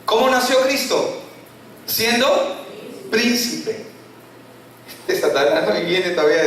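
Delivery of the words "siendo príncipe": usual speaking voice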